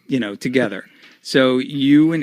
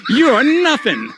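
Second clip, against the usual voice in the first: imitating a hammed-up wrestling promo, much higher; hammy dad promo; 10 semitones higher, 5 dB louder than usual. Cena's dad threatening Edge in the vignette, hammed-up high voice. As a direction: pitch it way up and ham it like an amateur actor. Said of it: "and it's like".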